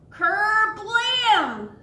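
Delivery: loud, high "Kerblam!" blast; hammed-up explosion sound; cannon boom. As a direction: burst out the cannon noise. Cannon fires in story.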